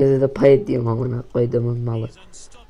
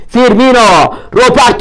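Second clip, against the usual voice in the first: high, loud urgent yell; shouting; 8 semitones higher, 10 dB louder than usual. Real, not acted. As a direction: call the player on. Urging player.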